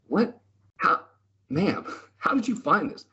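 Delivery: high, amazed disbelief; squeaky mock astonishment; stunned. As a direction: act floored by the old lady's shortcut. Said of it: "speaker's own stunned reply".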